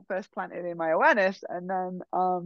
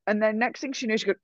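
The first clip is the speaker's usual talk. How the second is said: normal talking voice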